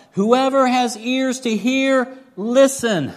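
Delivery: loud, slow and high, calling to the crowd. Acted. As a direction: lift the voice and call it out slowly to everyone listening. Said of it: "he called out".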